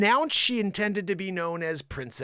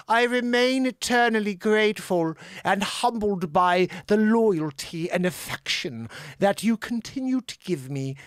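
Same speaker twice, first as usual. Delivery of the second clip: the Queen. Acted. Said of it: "the Queen said".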